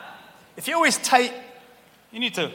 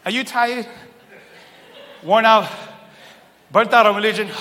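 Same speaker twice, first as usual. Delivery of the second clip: louder second reading; 4 semitones higher, 7 dB louder than usual. Shown as a demonstration. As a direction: punch it out louder, like an infomercial pitch. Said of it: "Watch how the Message puts it".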